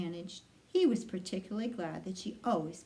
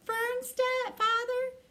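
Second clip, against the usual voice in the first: much higher, stunned squeal; horrified squeal; slower than usual. Acted. Pig hearing Fern's father will kill him, squealing. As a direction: squeak it out in shock.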